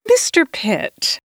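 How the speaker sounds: nervous politeness, a touch unsteady; slight quaver. A narrator's reading. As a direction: feign a casual greeting through nerves. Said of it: "the slight quaver in my voice".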